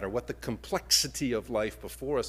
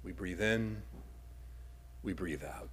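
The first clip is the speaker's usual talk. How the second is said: guided breathing; 4 semitones lower, 10 dB quieter than usual. Shown as a demonstration. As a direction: lead the room through one breath. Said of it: "guiding a breath".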